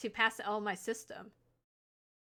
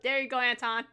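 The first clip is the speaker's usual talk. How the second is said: normal talking voice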